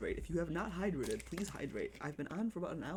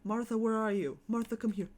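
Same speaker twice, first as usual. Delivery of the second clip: calling out; 5 semitones higher, 7 dB louder than usual. Real, not acted. Calling out to the pet cat.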